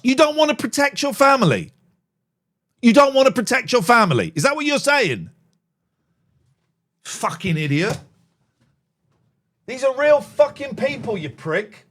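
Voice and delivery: loud, high furious yelling; furious rant. Real, not acted. [name] yelling at commenter Emma, furious. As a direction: rage at the accusation.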